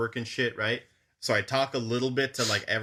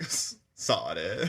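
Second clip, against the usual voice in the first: slow low mutter; 6 semitones lower, 5 dB quieter, much slower than usual. Retold or imitated. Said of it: "a unique voice".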